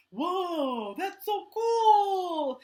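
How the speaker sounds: slow delighted puppet gasp of awe; amazed. Pretend. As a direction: wow at the wonder of it. Puppet amazed by eye lines.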